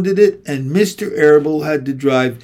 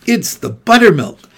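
usual speaking voice

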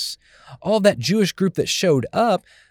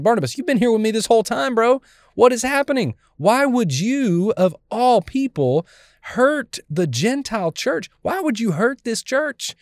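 much higher, pleading rebuke; Paul pleading with Barnabas, raised high and hurt; furious Paul; 7 semitones higher than usual. Retold or imitated. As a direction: plead with a friend who let you down, pitch up high. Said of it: "Paul absolutely is furious".

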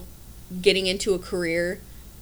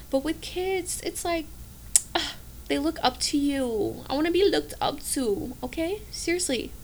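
soft gushing; 6 semitones higher, 7 dB quieter than usual. Real, not acted. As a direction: melt a little, sweetly wistful. Gushing about kids.

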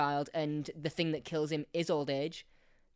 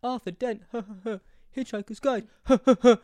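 mock Tenth Doctor; 6 semitones higher, 8 dB louder than usual. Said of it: "And he's like".

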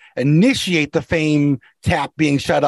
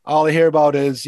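ordinary talk, nothing marked

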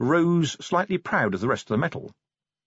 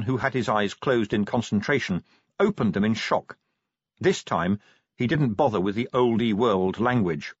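plain reading voice

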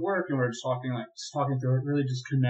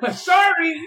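mock apology; 14 semitones higher, 6 dB louder than usual. Mock apology, laughing story.

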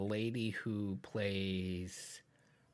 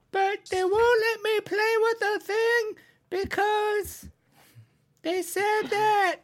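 real dramatic protest, slow and very high; drama queen; 4 dB louder, slower than usual. Real, not acted. Child complaining that they won't let her play, drama queen. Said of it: "a drama queen".

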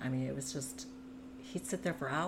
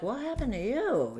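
mock concerned asking; 10 semitones higher, 5 dB louder than usual. Passers-by asking about her injuries.

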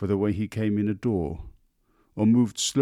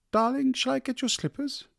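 high, sweet-voiced wife; doting wife. A narrator's reading. Wife offering her husband his slippers, high. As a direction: fuss over him gently in a high voice.

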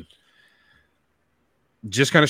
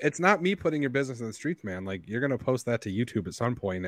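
ordinary talk

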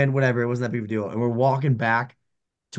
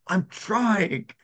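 high strained mock reply to the coach; pitched-up, comic protest; struggling fighter; 7 semitones higher than usual. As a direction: imitate a pinned fighter protesting. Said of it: "he's like".

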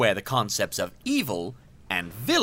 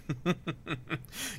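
villain chuckle; 5 semitones higher, 11 dB quieter than usual. Acted. Villain gloats over own brilliance.

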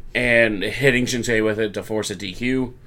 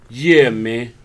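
lightly voiced Australian "Yeah, man", louder; a hint of an Australian wrestler; Australian accent; 6 dB louder than usual. Bronson Reed butting in, mock Australian accent. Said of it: "whatever Australian accent".

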